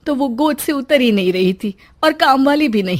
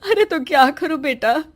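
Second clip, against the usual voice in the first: usual speaking voice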